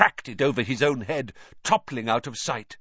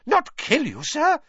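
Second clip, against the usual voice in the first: high, squeaky elf protest; aghast high-pitched elf; shocked house-elf; 3 dB louder than usual. A narrator's reading.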